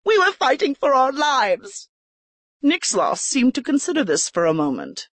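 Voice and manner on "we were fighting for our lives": forced indignation. A narrator's reading. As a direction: act offended to sell the lie. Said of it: "forcing indignity into her voice".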